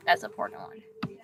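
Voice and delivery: unvoiced muttered aside; whispering. Real, not acted. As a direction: whisper it to yourself.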